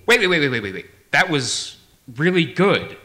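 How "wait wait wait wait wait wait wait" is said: urgent interruption. Acted. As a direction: break in urgently on the performer. Director interrupts the impression.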